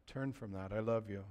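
very soft, low, loving correction; gentle. Acted. Jesus calling someone back with love, very gently. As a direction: hush it down into a tender plea. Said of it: "comes to us with gentleness".

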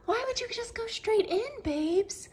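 high, quick pet-talk voice. Real, not acted. Owner cooing at her cat in the carrier, high baby talk.

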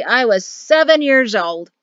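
put-on loud, proud old-man declaration. A narrator's reading. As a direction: announce it with pride. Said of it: "he said proudly".

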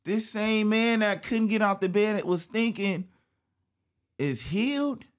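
astonished, rising voice; amazed. Real, not acted. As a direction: tell it like a miracle. Amazed at the man healed.

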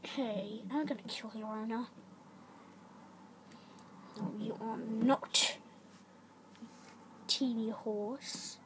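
low, quiet threatening voice; menacing horse. Acted. Horse threatens to kill the other's owner, menacing. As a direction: taunt them low and slow, drawn out.

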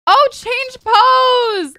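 loud, high, drawn-out exclamation; excited squeal. Real, not acted. Player discovers she can change pose, excited shout. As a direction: cry out at a thrilling discovery. Discovers new selfie option.

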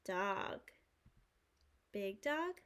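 ordinary delivery

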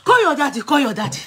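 retold urgent command; urgent instruction. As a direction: press her to call daddy now. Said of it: "I said".

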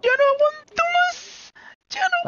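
very high, drawn-out squeal; hammed-up falsetto cry of giving up; mock wail. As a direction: squeal it in comic despair. Comic cry of being fed up.

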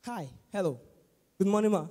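soft, low, restrained hello; understated, orderly good-morning. Retold or imitated. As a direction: greet gently and courteously.

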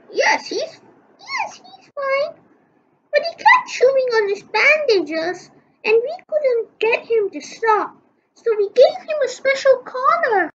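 high put-on nurse voice. Nurse reassures Chief about Dogman, high and light.

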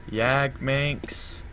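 ordinary talk, nothing marked

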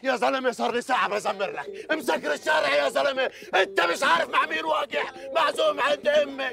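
high-pitched, frantic, fed-up ranting; exasperated complaint. Acted. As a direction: vent about the traffic and the in-laws in one breath. Late guest complains loudly.

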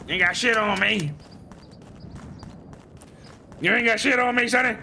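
cocky Southern gunslinger gloating; put-on Southern accent. Gamer gloating at a downed enemy in a Southern accent. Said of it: "So I'm gonna do this accent right here".

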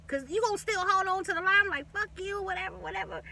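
high, fading scoff; mocking dismissal. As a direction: brush him off high and then trail away. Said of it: "I was like".

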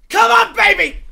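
loud, high cheering shout. Real, not acted. Streamer yelling at the pack reveal, loud cheer. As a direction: yell it at the screen.

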